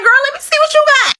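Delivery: high, fast excited ask; excited girlfriend quote. Retold or imitated. To a friend on a cruise, high eager gush. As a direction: gush at her to show the bag. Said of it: "So I'm like".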